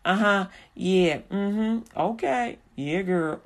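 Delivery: reluctant listener. Acted. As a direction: grudgingly go along, under your breath. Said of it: "you kinda going".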